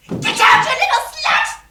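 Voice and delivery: very high, explosive angry yell; furious shout. Acted. Wife orders girl off Raja's bed, furious shriek. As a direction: shriek it to drive her out.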